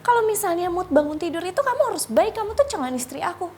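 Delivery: high, loud, fast demanding rant; mock angry boyfriend. Boyfriend demanding she be sweet after his nap, high loud mock anger. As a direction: hammer him insisting she be nice, high and fast.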